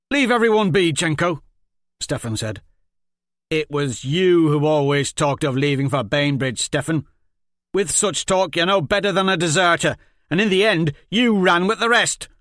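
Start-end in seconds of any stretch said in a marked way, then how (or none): 0.1-1.4 much higher, loud warning; loud rebuke. Acted. Stefan tells Jenko to stop, loud rebuke. 3.5-12.3 loud, higher accusing rant; angry accusation. Acted. Accuses Stefan of running away, loud and angry.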